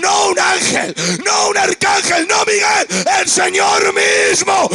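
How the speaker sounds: full-voiced Pentecostal climax; fiery preaching. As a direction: cry it out to the congregation.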